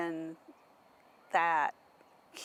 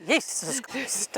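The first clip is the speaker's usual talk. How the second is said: slow, drawn-out Swedish accent; 6 semitones higher, slower than usual. Shown as a demonstration. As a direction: say it the Swedish way, laughing. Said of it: "with the Swedish accent".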